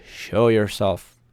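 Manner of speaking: ordinary talk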